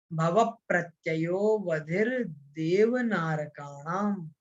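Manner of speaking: slow, low, measured chant of a Sanskrit verse; sutra recitation. Shown as a demonstration. Teacher demonstrating the sutra for students to hear, slow recitation. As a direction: draw out each syllable in an even, rolling lilt. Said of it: "सबसे पहले मैं इसका उच्चारण करूंगा".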